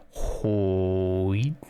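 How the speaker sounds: ordinary delivery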